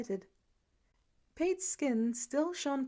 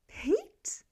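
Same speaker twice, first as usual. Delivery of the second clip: urgent breathy hiss of a name, pitched high; whisper yell; 10 semitones higher than usual. A narrator's reading. Laura whisper-yells the name under the violin practice. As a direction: call out without making noise. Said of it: "Laura whisper yelled it".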